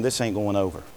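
muttered aside. Retold or imitated. Adam's inner thought.

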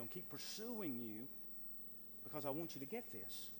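soft, low and tender plea; a quiet, loving call from God; gentle appeal as God. Acted. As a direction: coax them gently, close to the mic. God's tender appeal voiced.

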